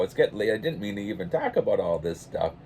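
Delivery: ordinary delivery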